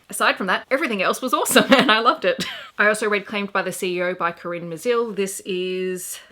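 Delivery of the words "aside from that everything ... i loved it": loud, bright, fast, laughing through it; laughing and gushing. Real, not acted. Reviewer gushing about the book to viewers, laughing loudly. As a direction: burst out happily with a laugh.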